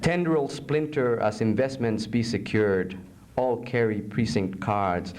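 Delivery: low, measured poetry reading; solemn poem narration. Acted. Poet reading a poem about torture, low and solemn. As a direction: intone the grim images evenly.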